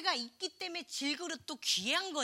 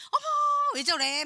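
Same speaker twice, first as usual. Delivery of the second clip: mock gossip; 13 semitones higher, 11 dB louder than usual. Mocking a judgmental believer.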